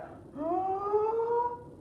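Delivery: huge, high, drawn-in gasp; big inhale. Acted. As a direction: suck in air like a dragon. Dragon inhales deeply.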